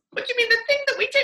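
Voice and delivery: high, quick, baffled wife's voice; puzzled wife. Retold or imitated. Wife asks husband what he means, high and fast.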